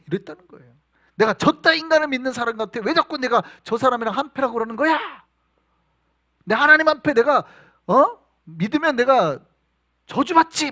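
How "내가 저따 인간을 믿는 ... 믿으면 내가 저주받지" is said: high, loud furious denial; cursing Peter. Acted. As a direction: curse him out to save yourself. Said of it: "막 이랬단 말이에요". (예수를 저주하는 베드로의 높고 큰 분노)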